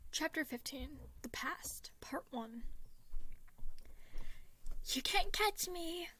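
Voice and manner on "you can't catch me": put-on high, slow taunting child call; playful yell. A narrator's reading. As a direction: tease him while running off. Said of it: "Roman yelled".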